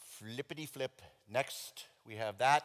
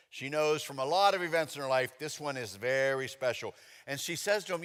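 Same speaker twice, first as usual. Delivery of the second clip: ordinary delivery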